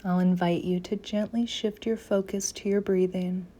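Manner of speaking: usual speaking voice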